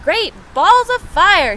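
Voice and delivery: high, loud astonished exclamation; excited cry. Acted. Goose lumbering by sees the nest, loud high cry. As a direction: blurt it out like a startled goose. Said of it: "she cried".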